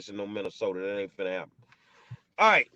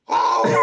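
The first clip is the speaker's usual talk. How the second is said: sudden high yelp of dismay; dismayed cry; 6 dB louder than usual. Real, not acted. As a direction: cry out in shock at the loss. Just lost pack of cigarettes.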